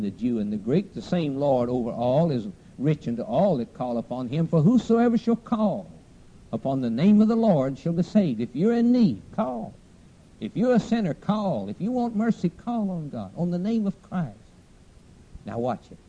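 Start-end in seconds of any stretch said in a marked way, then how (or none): 4.5-14.4 high, ringing preacher's plea; fervent altar appeal. Real, not acted. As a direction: urge the sinners in a high preaching voice. Preacher appeals to sinners to call.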